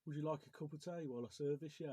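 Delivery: Midlands accent. Shown as a demonstration. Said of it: "say that in a Midlands accent".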